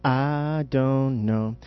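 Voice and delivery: very slow "I don't know", every word stretched; slow-motion answer, settling on "know"; drawn-out answer. Shown as a demonstration. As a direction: stretch "I", "don't" and "know" like long played notes. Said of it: "And I gave the answer".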